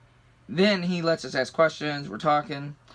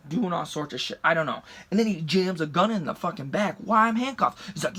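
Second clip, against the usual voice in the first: ordinary delivery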